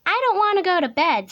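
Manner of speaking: high, loud defiant cub; protesting Little Tiger. A narrator's reading. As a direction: refuse loudly in a child's high voice. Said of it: "said Little Tiger".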